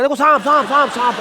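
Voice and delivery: mock alarm shout. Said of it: "चिल्लाने लगे".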